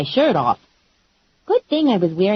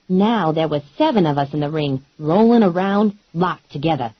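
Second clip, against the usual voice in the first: usual speaking voice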